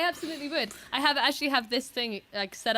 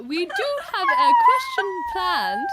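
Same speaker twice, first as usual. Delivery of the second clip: much higher, slow, laughing posh British; very British accent; 7 dB louder, slower than usual. Pretend. Hosts on a stream, hamming up British to make amends. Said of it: "I'll try and do a very British accent".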